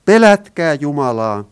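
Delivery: angel's herald call, loud; loud proclamation. Acted. Preacher quotes the angel's command to all, loud proclamation. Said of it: "hän kuulutti kovalla äänellä".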